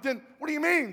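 high, loud demand for explanation; hammed-up arguing husband; exasperated yell. Husband argues back in retold quarrel.

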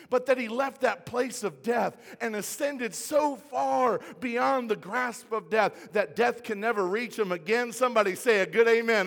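fervent preacher lifting the crowd; rousing preaching. Real, not acted. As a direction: build to a call for an amen. Rousing the congregation for amen.

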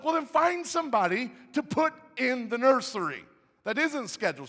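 very high, loud demand; exasperated shouted order. Retold or imitated. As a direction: yell it in mock outrage, pounding each phrase. Husband yells demand in retold quarrel.

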